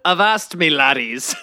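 pirate voice. Pretend. Pirate voice joke.

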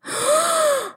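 high, sharp intake of breath; shocked gasp. A narrator's reading. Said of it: "gasped in shock".

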